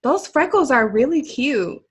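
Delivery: high, slow, sugary cashier voice; mock-sweet shop clerk; imitating the cashier. Cashier asking a customer about freckles, mock sweet. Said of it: "the cashier was like".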